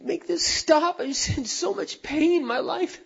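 put-on high, pleading voice of a sobbing older woman; sobbing plea. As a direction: beg through tears, lightly imitated. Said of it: "just sobbing".

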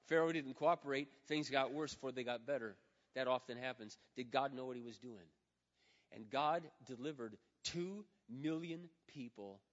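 ordinary talk

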